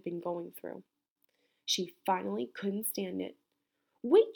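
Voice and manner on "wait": much higher, louder call; cry out. A narrator's reading. Camilla stopping the old woman leaving, loud high call. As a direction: call her back urgently. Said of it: "she cried".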